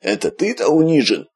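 high, mocking smirk. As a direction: scoff at him with a grin. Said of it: "усмехнулся писатель".

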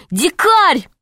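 high, falling call to a friend. A narrator's reading. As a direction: cry the name out high, without extra volume. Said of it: "закричал он". (окликает криком, высоко)

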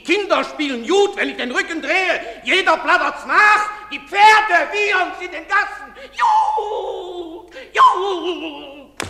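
high, loud, raging outcry; furious shouting. Acted. A father rages that the town mocks him, furious shouting. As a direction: shout your fury at the whole town. Furious outburst about the gossip.

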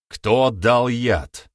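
slow, loud, pressing demand; demanding shout. A narrator's reading. Accuser presses the lying young man, slow loud demand. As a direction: hammer out each word of the accusation.

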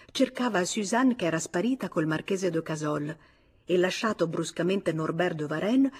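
ordinary delivery

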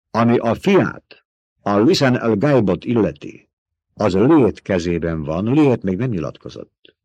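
scornful fighter rejecting a bribe; proud Fremen warrior. A narrator's reading. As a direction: Fremen warrior scoffing at being bought.

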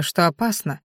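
ordinary delivery

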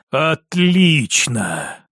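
low, very slow, satisfied word; prince's drawn-out satisfaction; exhaled relief. A narrator's reading. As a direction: breathe it out long and low. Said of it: "выдохнул князь".

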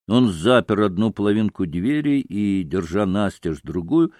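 usual speaking voice

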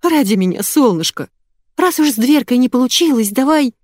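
coaxing in a rising pitch; pleading. A narrator's reading. Diana coaxing husband for her sake, pleading. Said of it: "умоляюще протянула Диана".

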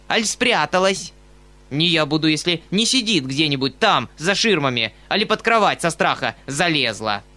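scorn him with rough fury; angry mocking rant. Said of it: "заревел он с яростью".

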